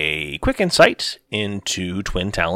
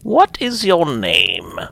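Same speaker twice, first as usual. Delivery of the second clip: slow, higher; old holy woman; 7 semitones higher, slower than usual. Acted. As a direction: ask slowly. Old seer voiced in story.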